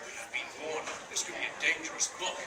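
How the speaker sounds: low ominous whisper; ominous hush. Acted. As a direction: whisper it like a spooky warning. Reading a spooky warning.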